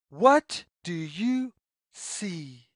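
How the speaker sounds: plain reading voice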